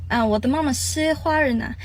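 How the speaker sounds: ordinary delivery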